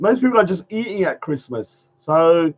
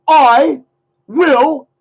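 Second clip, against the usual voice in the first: louder and higher I will, split by a pause; mock bold vow; 7 semitones higher, 7 dB louder than usual. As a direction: brace yourself and blurt out the promise. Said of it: "I can't say it".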